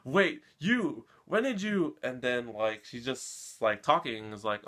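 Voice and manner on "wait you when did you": sudden high startled question; stunned, high-pitched retelling of his shock; surprised. Retold or imitated. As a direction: catch him off guard, voice jumping up. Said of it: "he's just surprised to see her".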